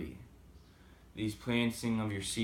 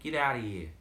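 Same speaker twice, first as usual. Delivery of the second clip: a hint of Boston in get outta here; light Boston accent; 3 semitones higher, 4 dB louder than usual. Shown as a demonstration. Vlogger showing off his Boston accent. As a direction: toss it off with a touch of Boston. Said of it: "I got a little bit of a Boston accent".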